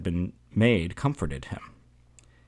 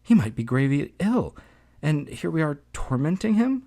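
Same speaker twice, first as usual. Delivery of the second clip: higher, mildly weepy mother's voice; tearful crying out; 8 semitones higher than usual. A narrator's reading. Mother pleads for her ill son, mildly weeping. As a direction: plead in a raised, lightly tearful voice. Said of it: "Gregor's mother cried, already weeping".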